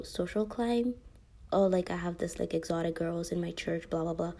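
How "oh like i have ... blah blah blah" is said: ordinary talk, nothing marked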